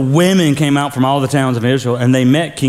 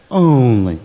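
resentful only; 5 semitones lower than usual. Shown as a demonstration. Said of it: "say it like that".

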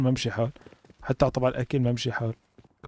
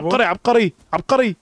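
excited; 7 semitones higher, 4 dB louder than usual. Real, not acted. Excited cheering at a teammate.

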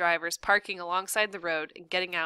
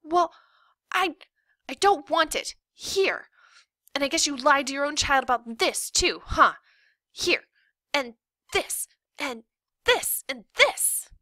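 put-on high-pitched, loud, halting kid tantrum; indignant girl, punching out short phrases; outraged daughter; 7 semitones higher, 5 dB louder than usual. A narrator's reading. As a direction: fling each thing back in a child's voice.

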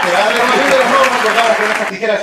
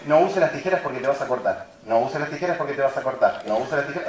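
low, soft nagging refrain; hushed, low-pitched parental nag; imitated parental warning; 5 semitones lower, 6 dB quieter than usual. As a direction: repeat it softly like a parent's warning. Parents' repeated childhood warning, retold.

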